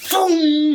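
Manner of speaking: high, drawn-out vocal whoosh; imitated boom. Half the room's hands going up, high voiced boom. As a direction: make a big boom effect. Sound effect of hands shooting up.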